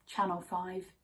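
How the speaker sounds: normal talking voice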